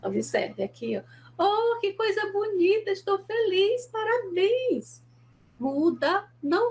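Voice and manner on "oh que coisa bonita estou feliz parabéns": beam with delight as you congratulate; happy demo, higher, stretching out the last word; calm and happy. Shown as a demonstration. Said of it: "se eu estiver calma e feliz".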